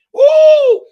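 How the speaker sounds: a loud high victory woo; joyful whoop. Real, not acted. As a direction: shout it out in triumph. Celebrating the CPA's yes.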